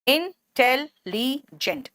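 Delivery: "Intelligent" very slow, in-tel-li-gent; four separate beats on "intelligent", a pause after each; broken into syllables. Shown as a demonstration. Teacher showing four syllables, word split up slowly. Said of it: "चार शब्द खंड हमें दिख रहे".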